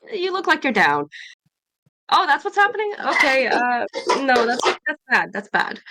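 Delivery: quoted character. Retold or imitated. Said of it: "She's like".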